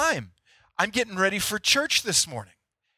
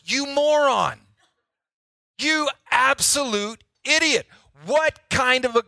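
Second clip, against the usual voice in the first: high, drawn-out mock outburst at himself; angry self-berating; 7 semitones higher than usual. Golfer scolding himself after hooking a shot, exasperated and high. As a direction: re-enact exasperated self-insults, pitched way up.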